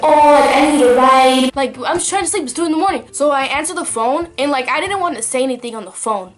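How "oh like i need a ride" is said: mock late-night caller. To a sleepy girl, a caller begging for a ride, loud and whiny. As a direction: play the friend who wants a lift, loud. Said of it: "They'll be like".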